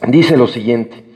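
usual speaking voice

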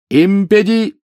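very high, loud incantation; shouted spell. A narrator's reading. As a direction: start a spell in a high yell. Harry casting spell mid-fight. (высокий громкий выкрик заклинания)

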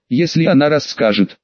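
usual speaking voice

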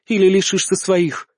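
plain reading voice

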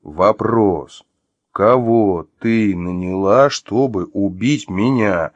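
slow, separated, deliberate words; firm, word by word. Acted. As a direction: separate every word firmly. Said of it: "твёрдо произнёс, разделяя каждое слово".

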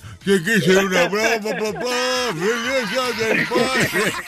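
slow, dopey imitated mumble; Rocky voice. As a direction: lightly mimic a slow tough guy. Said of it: "habla como Rocky, todo madero".

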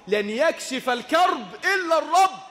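full-force pulpit declaration; preaching shout. Acted. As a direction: call it out to the whole mosque. Preacher proclaims a saying.

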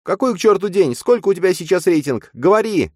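high, loud furious demand; furious yelling. Acted. Nastya whips Matvey and demands his rating, furious. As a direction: bark it at him, high and loud.

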